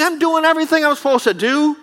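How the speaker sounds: high, indignant whine; self-righteous Jonah. Acted. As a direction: protest your own good work. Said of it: "He's like".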